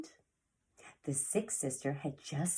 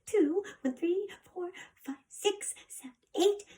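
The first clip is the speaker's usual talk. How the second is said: excited counting; 13 semitones higher than usual. Acted. Sister counting trees aloud.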